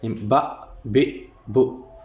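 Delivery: very slow बा, बे, बो, each syllable drawn out; syllable drill. Shown as a demonstration. Teacher recapping बा बे बो for pupils, slow. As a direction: chant बा बे बो slowly. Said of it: "फिर उसको पढ़ेंगे हम बो".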